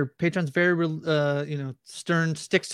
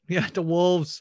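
put-on Boston accent; much slower than usual. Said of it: "Boston accents".

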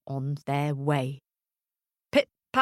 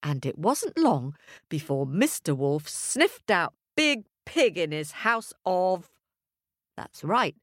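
ordinary delivery, nothing marked

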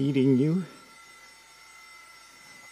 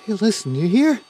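calling out; 10 semitones higher, 4 dB louder than usual. Acted. Calling out into space.

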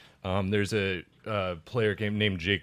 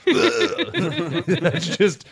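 ordinary talk, nothing marked

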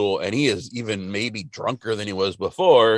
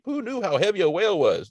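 complaining; 8 semitones higher than usual. Retold or imitated. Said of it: "he's like".

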